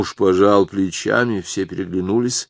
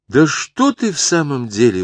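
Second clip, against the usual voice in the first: mild exclamation; 7 semitones higher, 4 dB louder than usual. Acted. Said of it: "воскликнула Соня".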